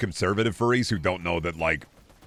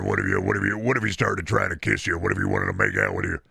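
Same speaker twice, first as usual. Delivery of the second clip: a put-on weird chatter voice, only lightly changed; hint of a goofy creep voice. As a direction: voice a creepy chatter pitching a gnome. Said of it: "I just like doing that voice".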